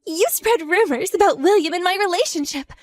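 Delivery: high, heated accusing voice; outraged confrontation; angry demand. Acted. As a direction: press her hard for an answer. Furious confrontation over rumors.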